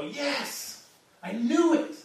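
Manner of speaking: like a fan whose hunch came true; mock eager cheer. Preacher mimics disciples who never cheered, mock eager. Imagined disciples hearing resurrection news.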